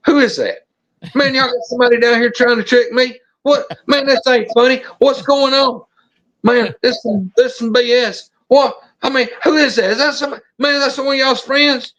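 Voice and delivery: retold agitation, pitched well up; agitated buddy. Buddy accusing friends of a prank, agitated. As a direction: play the worked-up buddy protesting a suspected prank.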